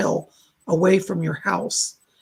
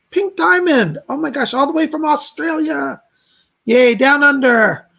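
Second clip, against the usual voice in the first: excited cheer; 6 semitones higher, 6 dB louder than usual. Real, not acted. Host cheering a donor from Australia, excited and louder. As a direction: celebrate the donation from far away.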